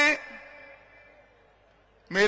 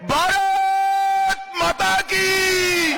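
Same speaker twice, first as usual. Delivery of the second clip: high, slow, drawn-out shout; roaring rallying speech; 7 semitones higher, much slower than usual. Real, not acted. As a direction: roar it to the whole crowd. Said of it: "प्रचंड आवाज से बोलिए".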